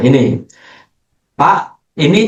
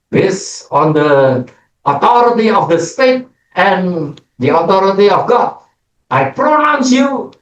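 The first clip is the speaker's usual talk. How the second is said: mock pastor; 5 semitones higher than usual. Pastor declaring the couple married, high solemn intoning. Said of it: "ya, saya berkata".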